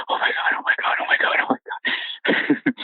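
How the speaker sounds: panicked whisper, repeated under the breath; quiet, breathless. Retold or imitated. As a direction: whisper in shock, trying to stay quiet. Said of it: "breathing really hard, but I was, like, trying to keep it, like, quiet".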